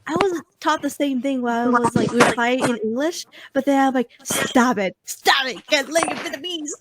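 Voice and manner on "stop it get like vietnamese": ordinary talk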